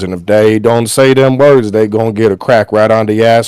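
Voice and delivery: hammed-up Southern cowboy. Cowboy persona defends swearing.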